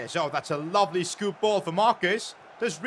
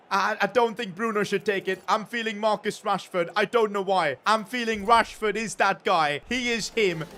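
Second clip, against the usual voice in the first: normal talking voice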